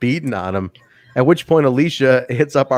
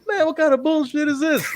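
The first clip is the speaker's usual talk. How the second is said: higher mock complaint; lightly voiced annoyed wrestler; imitating Willie. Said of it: "hear Willie in the Mac go".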